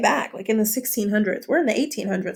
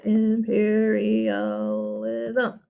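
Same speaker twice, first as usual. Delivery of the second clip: slow spelling-out; much slower than usual. Shown as a demonstration. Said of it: "uh, what is it?".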